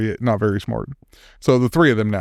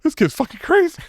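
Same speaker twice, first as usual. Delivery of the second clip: shocked; 14 semitones higher than usual. Retold or imitated. Bank guys voiced shocked.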